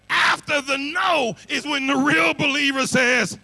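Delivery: loud, high preacher's shout; preaching shout. Acted. Preacher hammering the point at the congregation, shouting. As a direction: shout it out to the pews.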